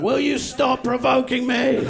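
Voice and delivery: high, slow, fed-up shark; annoyed shark voice. Pretend. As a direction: play the shark, slowly and pleadingly exasperated. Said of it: "Shark's like".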